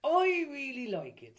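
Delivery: high, slow, loud Irish lilt; mock Irish accent. Co-host approves of the bit, high mock Irish accent. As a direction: stretch it out in hammed-up Irish. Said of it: "do this Irish".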